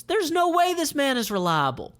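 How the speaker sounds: high, scoffing commander; Melek's voice. Acted. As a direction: dismiss the witness. Melek character voice.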